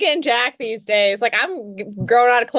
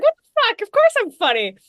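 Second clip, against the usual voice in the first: indignant surprise; 9 semitones higher than usual. Retold or imitated. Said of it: "And I was like".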